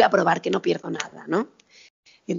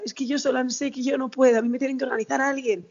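higher, plaintive imitation of mum; tearful plea; 4 semitones higher than usual. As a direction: mimic mum's helpless pleading, pitched up.

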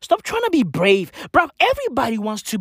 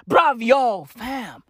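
ordinary talk

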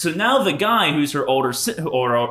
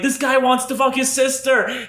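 high, raised-voice taunt; mock taunting yell; 10 semitones higher than usual. Retelling taunts at an angry brother chasing them, high mock yell. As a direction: re-enact heckling someone, pitched up. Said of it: "I'm laughing the whole time".

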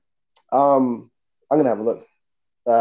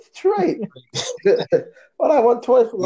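laughing; 9 semitones higher than usual. Real, not acted. Joking about toys, laughing.

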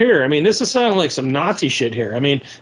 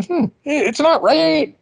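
slow, drawn-out, much higher mock ponder; mock thoughtful musing; 10 semitones higher, much slower than usual. Host urging listeners to question Soros, mock pondering. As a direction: ham up a dawning doubt. Host voicing sensible public reaction.

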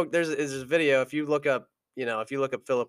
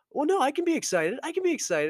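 much higher, fast, giddy insistence; excited retort; 13 semitones higher, faster than usual. Retold or imitated. Quarterback to the annoyed opponent, giddy high retort. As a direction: play it like a wound-up kid defending himself. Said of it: "said, like".

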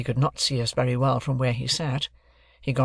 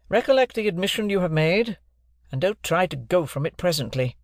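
raised, deliberate lawyer's voice; stern lawyer; 6 semitones higher than usual. Acted. Jaggers introducing himself to Joe and Pip, stern lawyer. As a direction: announce yourself like a cool London lawyer.

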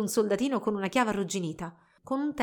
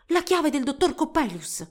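high, excited exclamation; 6 semitones higher, 4 dB louder than usual. A narrator's reading. Girl finding the doctor's key, exclaiming. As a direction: exclaim at a sudden find. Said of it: "esclamò".

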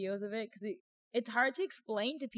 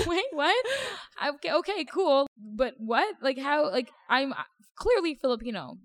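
loud, higher-pitched incredulous retelling; raised voice, bewildered protest; baffled disbelief; 7 semitones higher, 7 dB louder than usual. Retold or imitated. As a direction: act out being thrown and indignant. Acts out reaction to being misidentified.

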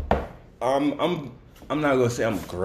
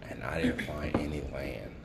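breathy hushed whisper; 5 semitones lower, 10 dB quieter than usual. Real, not acted. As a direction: tell it in a near whisper, awed. Hushed account of endless water.

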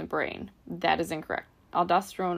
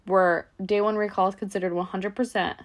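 normal talking voice

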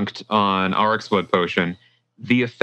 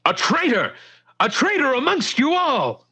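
much higher, rising angry cry; furious accusation. Acted. Mikhail accuses the tavern crowd in a high furious cry. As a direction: denounce the traitor with blade drawn.

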